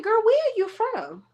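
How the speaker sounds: put-on squeaky high incredulous girl; mock high girl. Vlogger mocking Alexis's accent, high squeaky disbelief. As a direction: shoot it up high, baffled. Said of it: "that accent that Alexis has".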